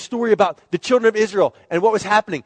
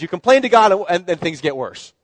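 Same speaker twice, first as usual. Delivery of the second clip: ordinary talk, nothing marked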